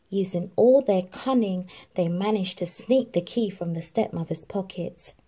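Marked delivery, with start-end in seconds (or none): none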